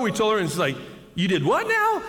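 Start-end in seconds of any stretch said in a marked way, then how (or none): 1.1-2.0 high-pitched mock disbelief; incredulous clerk. Hotel clerk to guests who ran the valley, high incredulous reaction. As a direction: imitate an appalled clerk, voice jumping way up.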